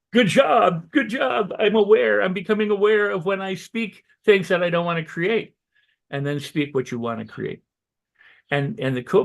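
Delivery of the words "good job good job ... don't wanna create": pumped-up pat on the back; excited self-praise. Pretend. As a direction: cheer yourself on like a proud coach. Self-praise after catching a slip.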